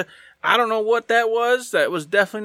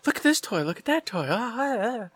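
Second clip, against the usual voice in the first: higher, breathless, fading quieter; mock childish gushing; 5 semitones higher, 4 dB quieter than usual. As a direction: gush like a kid spotting toys. Imitating a kid gushing over toys.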